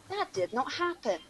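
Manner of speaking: ordinary talk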